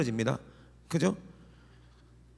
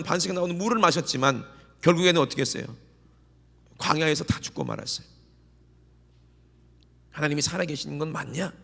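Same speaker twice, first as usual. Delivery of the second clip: ordinary talk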